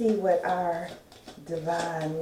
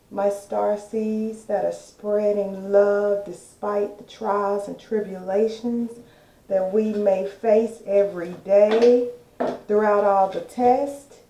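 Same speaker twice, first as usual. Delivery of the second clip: loud, fervent uplifting address; rally the faithful with passion; preaching; 2 semitones higher, 7 dB louder than usual. Acted. Preaching to her listeners.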